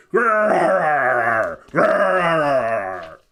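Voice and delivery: loud growling bear laugh, grr har har; a bear roaring with laughter; bearish laugh. Acted. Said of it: "laughing so hard it was crying".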